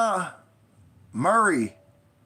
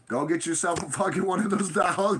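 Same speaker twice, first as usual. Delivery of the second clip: normal talking voice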